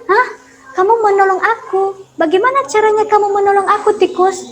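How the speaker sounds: proud trapped lion still mocks the mouse; arrogant lion. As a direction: laugh off the mouse's offer. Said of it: "dengan masih sombongnya singa".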